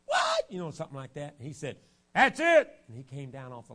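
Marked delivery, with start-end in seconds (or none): 0.1-0.5 much higher, louder squeal; mock scream. Sister shrieks when her hand is stepped on, mock scream. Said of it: "she screamed". 2.1-2.7 much higher, louder angry shout; mock angry shout. Father hits his limit with the kids, angry shout.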